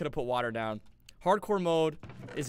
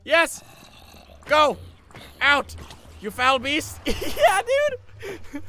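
high, loud victory yells; triumphant shouts; 10 semitones higher, 9 dB louder, much slower than usual. Real, not acted. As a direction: shout them out of the portal in triumph. Sends mobs into the portal.

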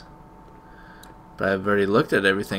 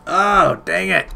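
annoyed outburst; 10 semitones higher, 8 dB louder, slower than usual. Real, not acted. Annoyed at the stuck game.

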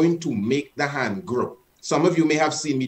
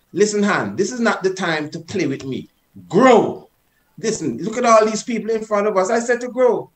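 stern scolding with raised pitch; disciplining a child; 4 semitones higher than usual. Shown as a demonstration. Teacher scolding his hand before an audience, stern. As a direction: tell it off like a disobedient child. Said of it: "as if you're disciplining your child".

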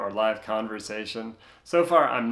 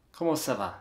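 "Comment ça va" softer, in a put-on French accent; a careful French-style "Comment ça va"; 3 dB quieter, faster than usual. Shown as a demonstration. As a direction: try "ça va" with rounded French vowels. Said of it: "with a more French type accent".

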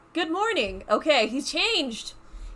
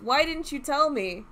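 normal talking voice

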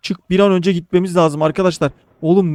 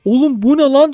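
ordinary delivery

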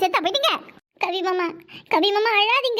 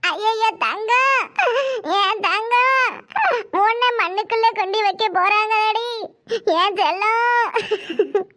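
mourning wail; much slower than usual. Acted. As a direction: wail over the body as if heartbroken. (மண்ணுக்குள்ள வைக்கப்போறாங்கன்னு ஒப்பாரி)

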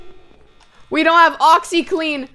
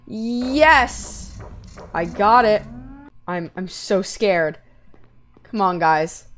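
ordinary talk

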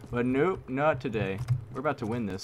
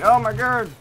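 high, loud outraged cry; shocked shout; 12 semitones higher, 13 dB louder than usual. Real, not acted. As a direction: yell as he dies. Gets killed.

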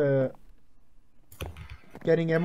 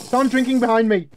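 alarmed warning; 7 semitones higher, 7 dB louder than usual. Real, not acted. Player warns team of enemy.

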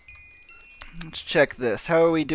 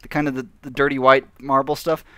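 ordinary talk

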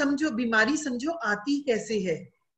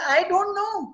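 mock puzzled; 9 semitones higher, much slower than usual. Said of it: "Sushma".

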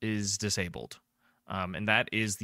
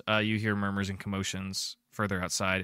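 ordinary talk, nothing marked

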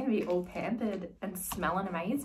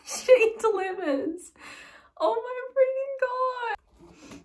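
loud, high squeal with laughter; thrilled; 5 dB louder than usual. Real, not acted. Friend delivers another gift, high loud delight. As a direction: gush at top pitch.

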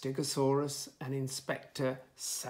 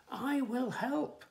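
high, slow dinosaur character voice; volunteer brightly as a storybook T-Rex; pitched-up, drawn-out offer; eager offer; 10 semitones higher, 4 dB louder, slower than usual. A narrator's reading. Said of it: "offered Trevor".

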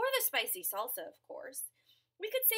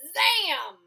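loud zam; 3 semitones higher, 12 dB louder than usual. Acted.

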